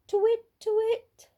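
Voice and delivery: high owl call, twit-twoo style; owl hoot. A narrator's reading. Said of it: "she went".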